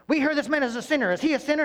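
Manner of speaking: fast, high, loud cop grilling; interrogator. Retold or imitated. Pharisees interrogating the healed man, hammed-up cop. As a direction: grill him like a detective under a lamp. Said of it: "they're interrogating him".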